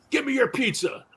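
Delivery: drugged-out guy. Retold or imitated. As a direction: mimic a stoned stranger grabbing for food. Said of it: "All drugged out".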